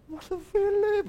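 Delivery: high, slow, imitating a tearful wife; melancholic plea. Wife tearfully calling the pastor's name, mock sad. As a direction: drag the name out like she's welling up. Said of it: "melankolis gitu ya".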